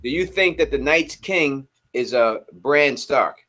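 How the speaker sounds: slow, clear repeated question. Real, not acted. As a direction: repeat it slowly for clarity. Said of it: "Say it again?".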